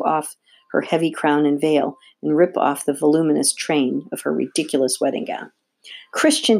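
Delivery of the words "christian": high-pitched call of distress; storybook cry of alarm; anguished cry. A narrator's reading. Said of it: "she cried".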